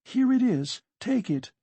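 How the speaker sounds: put-on high-pitched anxious young character voice; distressed protest. Sonia returns the disputed money.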